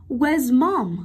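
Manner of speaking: "Where's mom?" with MOM dropping at the end; falling intonation. Shown as a demonstration. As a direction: let the pitch fall on "mom" to close the question. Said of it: "Sử dụng tông giọng đi xuống". (hạ giọng ở "mom" cuối câu)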